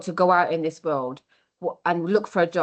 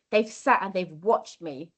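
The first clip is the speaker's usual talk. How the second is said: ordinary talk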